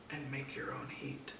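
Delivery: hushed. A narrator's reading. Narrator's hushed owling rule.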